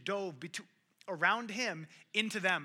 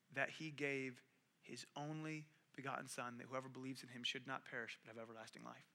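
quiet preaching; 4 semitones lower, 8 dB quieter than usual. Acted. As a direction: let the scripture land gently.

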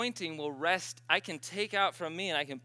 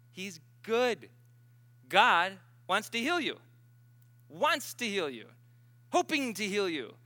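high, loud, punched exhortation; ringing, emphatic preacher delivery; forceful preaching; 4 semitones higher, 8 dB louder than usual. Real, not acted. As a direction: proclaim it to the congregation with full conviction.

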